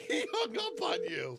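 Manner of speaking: laughing through the words. Real, not acted.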